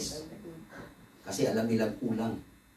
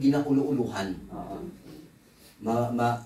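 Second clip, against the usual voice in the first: normal talking voice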